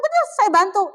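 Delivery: high and loud, jumping in to help; bright, eager yes I'll help; eager reply. Retold or imitated. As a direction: lively offer to help a friend. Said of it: "Saya bilang".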